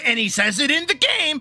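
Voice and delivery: normal talking voice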